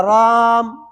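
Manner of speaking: رام drawn out slowly, the lips closing after the alif so a م sound creeps in; slow رام ending on pressed lips; mistaken closed lips. Shown as a demonstration. Teacher showing students the error, رام with lips shut at the end. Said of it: "دیکھو میم بن گیا".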